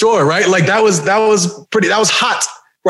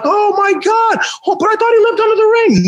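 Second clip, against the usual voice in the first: high-pitched imitation of shocked children; mock kiddie astonishment; amazed kids; 9 semitones higher than usual. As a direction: gasp it like a disillusioned young fan. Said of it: "all the kids would have been like".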